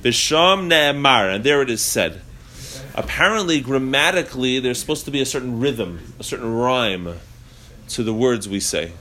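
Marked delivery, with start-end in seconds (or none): none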